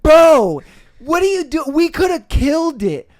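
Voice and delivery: loud incredulous bro; exasperated yell. Retold or imitated. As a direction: yell at your friend for blowing it. Exasperated at missed roach.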